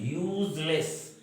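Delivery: "यूजलेस" with the opening "yoo" stretched long; "यूजलेस" stretched to show its first sound; drawn-out pronunciation. Shown as a demonstration. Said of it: "उच्चारण क्या हो रहा है?".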